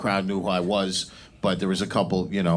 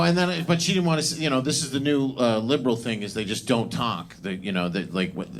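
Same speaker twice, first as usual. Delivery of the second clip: ordinary talk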